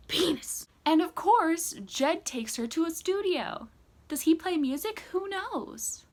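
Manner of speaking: high, loud sarcastic exclaiming; loud mock outburst. Vlogger mocking Jed's studio date, loud and exasperated. Joke blurt, sarcastic recap of Jed.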